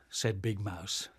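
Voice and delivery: usual speaking voice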